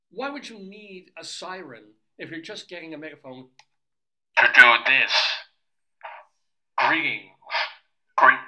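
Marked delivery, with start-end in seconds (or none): 4.4-5.1 loud megaphone voice; through a megaphone. Real, not acted. Testing the megaphone siren. 6.8-8.4 amplified megaphone call; loud bullhorn announcement; through a megaphone. Real, not acted. Testing the megaphone.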